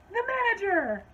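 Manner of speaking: high, loud callback holler; hammed-up shrieky wail; mock customer yell. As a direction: punch it out like the outraged customer.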